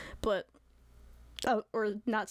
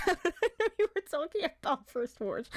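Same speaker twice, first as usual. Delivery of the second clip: 1 laughing; 7 semitones higher than usual. Real, not acted. Laughing apology to chat.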